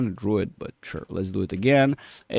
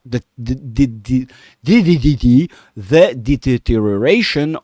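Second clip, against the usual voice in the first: tripping over the word, getting louder; real stuttering; 6 dB louder than usual. Real, not acted. Trader stuttering on their own words to viewers, stumbling. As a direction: stammer repeatedly, then force the phrase out. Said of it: "I'm stuttering on my own words".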